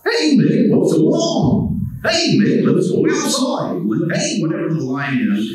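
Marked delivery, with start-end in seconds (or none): 0.0-4.4 Goofy takes for the director. Shown as a demonstration. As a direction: throw it up into the goofy register. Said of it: "give me three".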